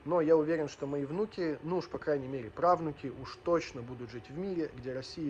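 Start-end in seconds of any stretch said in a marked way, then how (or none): none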